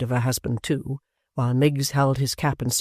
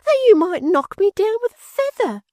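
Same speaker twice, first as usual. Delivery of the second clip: a squeaky, much higher, hammed-up fussy maid; overwrought maid; 7 dB louder than usual. A narrator's reading. Maid Miggs swooning.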